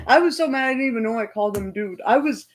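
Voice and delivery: ordinary talk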